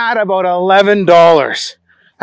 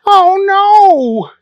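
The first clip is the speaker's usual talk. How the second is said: high, loud dismayed cry; cried out; 14 semitones higher, 6 dB louder than usual. Acted. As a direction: wail it out in dismay. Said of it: "he cried out".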